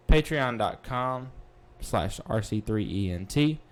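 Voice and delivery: normal talking voice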